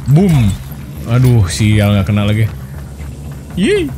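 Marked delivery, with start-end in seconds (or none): 3.6-3.9 gleeful squeal. Real, not acted. Squeals at hits in fight.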